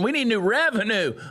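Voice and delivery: high mocking imitation of a politician; mocking quote. Mocking the mayor's words in a high, mocking voice. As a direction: parrot him in a high, snide voice.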